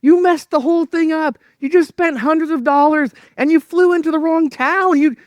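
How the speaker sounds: high, loud berating of himself; self-bashing rant. Retold or imitated. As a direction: scold yourself hard for flying to the wrong town. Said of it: "And so I'm bashing myself".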